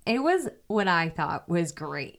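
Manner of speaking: ordinary delivery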